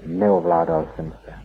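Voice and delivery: ordinary delivery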